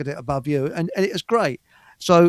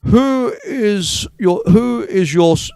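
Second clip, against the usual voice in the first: stern policeman; 5 semitones higher, 5 dB louder, slower than usual. Retold or imitated. Police officer demanding, retold.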